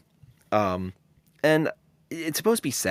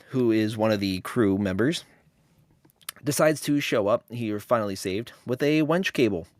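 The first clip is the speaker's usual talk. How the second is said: ordinary delivery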